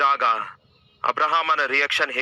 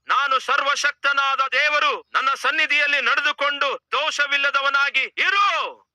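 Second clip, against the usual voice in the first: voice of God; 12 semitones higher than usual. Acted. God appears and commands Abraham, high booming proclamation.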